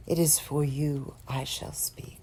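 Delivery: softly whispered promise; whispered vow. Acted. Speaker whispering a vow to his homeland.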